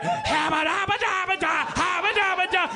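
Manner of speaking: high, sing-song nonsense babble; mock gibberish characters. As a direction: babble like paper mache lollipop people. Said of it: "lollipop people going like".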